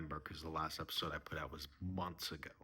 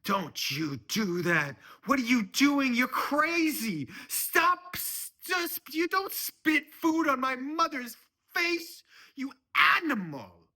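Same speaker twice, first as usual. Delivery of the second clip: high-pitched screaming outrage; furious yelling; 11 dB louder than usual. Acted. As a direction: shriek at him, very high and loud. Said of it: "is yelling at John".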